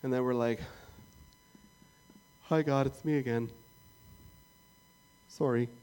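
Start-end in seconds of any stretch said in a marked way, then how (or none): 2.4-5.7 sheepish Canadian apology; Canadian sorry. Retold or imitated. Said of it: "That's how we say sorry in Canada".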